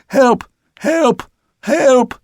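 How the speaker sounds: very high, desperate cries; high wailing calls, each on its own; shouting for help. A narrator's reading. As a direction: cry for rescue from far aloft. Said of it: "someone was shouting".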